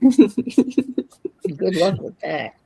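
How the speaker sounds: slow, laughing through words. Real, not acted. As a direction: giggle it out. Laughing reply.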